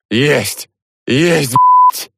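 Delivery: high, loud triumphant bark; barked shout. A narrator's reading. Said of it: "гаркнул я".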